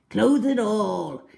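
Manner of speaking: slow, savoured menace; gloating relish. Acted. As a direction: draw out the treat with greedy pleasure. Crocodile relishes his answer.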